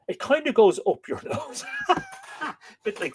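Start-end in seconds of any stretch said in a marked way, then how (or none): 0.1-1.6 laughing. Real, not acted. Jokes about a nasal sound. 1.7-2.5 high laugh. Real, not acted. Laughs at own joke.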